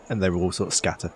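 ordinary talk, nothing marked

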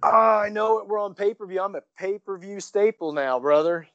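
hint of a Hulk Hogan voice; lightly hammed wrestler brag; Hogan swagger. Playing up Hogan persona.

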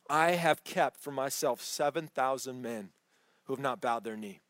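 God answering the despairing Elijah, low and calm. Acted. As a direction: settle him gently with quiet authority.

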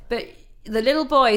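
normal talking voice

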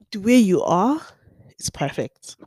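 talking through hiccups; hiccuping between words. Real, not acted. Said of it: "why do I keep hiccuping".

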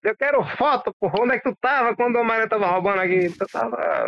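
high, heated boss rant; angry boss yelling. Retold or imitated. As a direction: chew him out like Jameson. Acting Jameson yelling at Peter.

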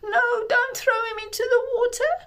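high, loud frightened cry; panicked plea. Acted. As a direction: cry out in alarm. Miriam fears for the baby.

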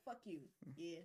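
half-muttered joking threat to fiance; quiet teasing curse; mock muttered threat. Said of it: "I'm like".

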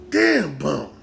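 exclamation falling away in pitch; impressed disbelief. Pretend. Fan to Bumpy over his new beef, impressed with falling tune. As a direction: let the amazement sink down, nearer a normal pitch.

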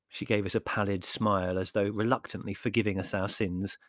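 plain reading voice

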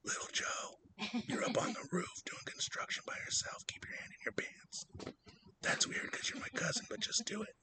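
very soft, playful whisper; hushed, hammed-up intimate tease; mock sexy whisper. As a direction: whisper naughty orders as a joke. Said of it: "like it's something sexy".

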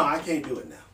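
normal talking voice

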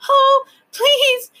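high, loud sobbing plea; begging. A narrator's reading. Lindy sobbing to the proc, begging. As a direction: beg in a high, crying wail. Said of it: "she begged".

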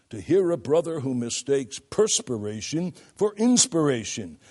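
usual speaking voice

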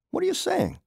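high-pitched, indignant demand; challenging question in a high voice. A narrator's reading. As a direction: demand an explanation, voice pitched right up. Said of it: "I demanded".

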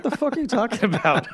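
high, incredulous comeback; acted bewilderment. Barber voicing the blamed coworker's reply, high-pitched bewilderment. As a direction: play the wrongly accused coworker.

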